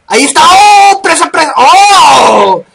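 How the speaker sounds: sudden loud gamer whoop; excited shout. Real, not acted. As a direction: yell out at the kill. Kill shots during gameplay. (grito de emoción)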